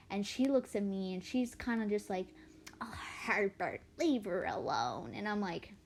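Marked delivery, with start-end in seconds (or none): 2.8-5.1 put-on slow, weary old-lady voice; old wife. Old wife telling husband Herbert to stop staring, weary. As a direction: tiredly tell your husband off.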